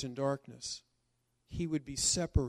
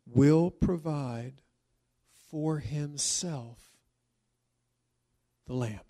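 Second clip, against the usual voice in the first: slow emphatic quote; 5 dB louder, much slower than usual. Shown as a demonstration. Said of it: "God," Abraham said, "will provide ... the lamb".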